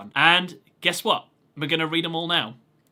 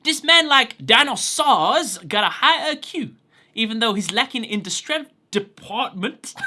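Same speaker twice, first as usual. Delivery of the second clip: put-on Brooklyn accent; 5 semitones higher, 4 dB louder than usual. Reading Joey-style English text.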